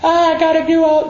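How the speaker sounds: high whiny protest; mock busy whine. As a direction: whine like you're too busy to rest. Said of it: "even though you're saying".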